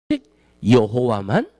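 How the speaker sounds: usual speaking voice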